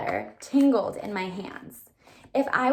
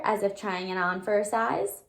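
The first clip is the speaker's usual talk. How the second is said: ordinary delivery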